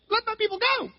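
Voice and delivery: a timid, squeaky little voice; weak high Moses. Retold or imitated. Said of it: "It was probably more like".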